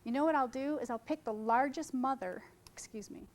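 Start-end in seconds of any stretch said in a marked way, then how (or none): 2.7-3.3 whispered aside. Real, not acted. Self-correction after awkward phrasing.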